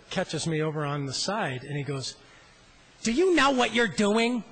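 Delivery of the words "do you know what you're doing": Kermit's challenge. Retold or imitated. Kermit confronting him on floor.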